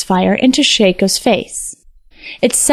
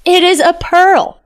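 much higher, insistent king; feigned innocence; 9 semitones higher than usual. A narrator's reading. Wicked king denies holding the soul globe, high insistent lie. King lies to his accuser.

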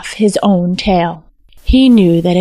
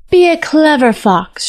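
higher, lightly called farewell; calling back; 6 semitones higher than usual. A narrator's reading. Vixen calls back to Shako as she leaves, light high call. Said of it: "she called back".

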